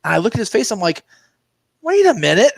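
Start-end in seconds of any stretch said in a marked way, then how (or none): 1.8-2.5 surprised. Retold or imitated. Sudden recognition retold.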